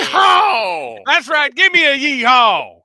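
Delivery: high, loud cowboy whoop; yeehaw. Acted. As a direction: holler like a rodeo cowboy. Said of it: "I can't keep that up".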